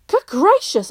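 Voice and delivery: astonished exclaim. A narrator's reading. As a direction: throw up your hands. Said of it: "exclaimed his grandmother".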